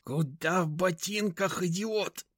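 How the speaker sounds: slow angry hiss. Acted. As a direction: hiss it at him, annoyed. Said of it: "зашипел".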